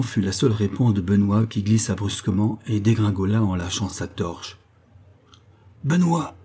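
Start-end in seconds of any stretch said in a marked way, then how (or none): 5.8-6.4 sharp, much higher call of alarm; alarmed cry. A narrator's reading. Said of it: "cria le forçat sérieusement alarmé". (appel alarmé vers le compagnon tombé)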